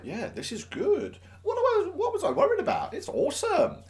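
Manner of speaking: high, pleased inner voice; smug self-satisfaction. Retold or imitated. Teacher secretly pleased with himself, high and smug. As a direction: congratulate yourself, delighted.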